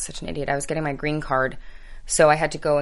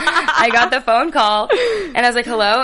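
giddy retelling; 7 semitones higher, 7 dB louder than usual. Retold or imitated. Recounting getting the big call.

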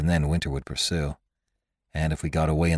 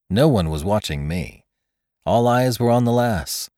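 ordinary delivery

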